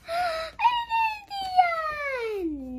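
high then dropping happy cry; thrilled announcement; excited exclaim. Real, not acted. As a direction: announce the end with delight. Excited at book's end.

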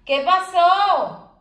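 high, slow, loud coaxing "¿Qué pasó?"; drawn-out fond question. Real, not acted. Owner coaxing her whining dog Irma, high and drawn out. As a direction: stretch it out to a fussing pet.

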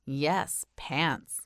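ordinary delivery

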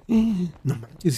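hesitant hum into slow disbelief; retold disbelief. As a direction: imitate a stunned friend, drawn out. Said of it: "la morra está así de".

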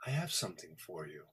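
low, soft, quick murmur; a gentle voice from within offering a gift; hushed inner voice. Retold or imitated. The Lord speaking to him in the car, low and hushed. Retold voice of God.

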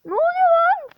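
very high squeal; squeaky alarmed. Real, not acted. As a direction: squeal in disbelief.

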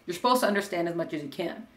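ordinary talk, nothing marked

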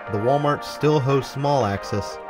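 usual speaking voice

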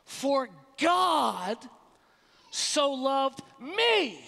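"me" drawn out slow and strong; stress on ME. Shown as a demonstration. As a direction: land hard on "me", slowly. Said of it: "Simply by emphasizing one of those words".

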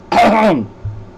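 loud hacking throat clear; harsh throat clearing. Real, not acted. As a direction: clear the throat hard, coughing fit. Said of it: "back after my coughing fit".

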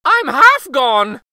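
high, loud and slow lament; drawn-out cry of a half-eaten cookie; high, loud, slow panicked gingerbread voice; frightened wail. Acted. Gingerbread boy being eaten.

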